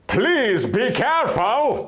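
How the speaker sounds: slow, high call across the lake; yelling after them. Acted. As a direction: call out after the fairies, drawn out. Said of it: "I yelled".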